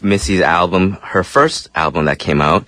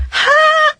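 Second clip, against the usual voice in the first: very high sharp squeak; falsetto note. Retold or imitated. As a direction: hit a piercing high note. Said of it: "before you would be like".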